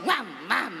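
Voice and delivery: mock dog bark mam mam; dog barking imitation. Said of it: "Ya itu, kayak dogi". (tiruan gonggongan anjing)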